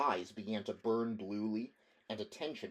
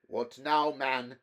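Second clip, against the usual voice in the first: higher, louder irritated snap; irritable exclamation; 3 semitones higher, 6 dB louder, slower than usual. Acted. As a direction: bark it out, annoyed. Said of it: "exclaimed Demetrio irritably".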